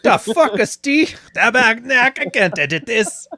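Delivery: hammed-up Quebecois accent, exasperated; Quebecois French accent. French-speaking editor complaining about the footage, Quebecois accent. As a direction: play an annoyed French-Canadian editor with a thick accent. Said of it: "the editors of the video speak French".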